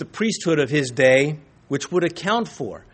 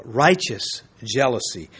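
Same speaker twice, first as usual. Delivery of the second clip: ordinary delivery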